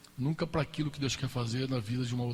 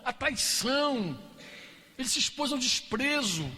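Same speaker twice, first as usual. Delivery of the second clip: fervent preaching; 9 semitones higher than usual. Real, not acted. Preaching builds to climax.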